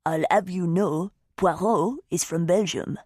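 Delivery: put-on slow, grand Belgian accent; deliberate, mock-haughty Belgian detective accent; Belgian Poirot accent. A narrator's reading. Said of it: "with a pretty impressive accent says".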